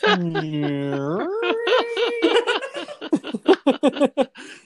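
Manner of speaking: drawn-out helpless laughter; long laugh. Real, not acted. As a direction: burst out laughing at yourself. Laughing about THX deep note.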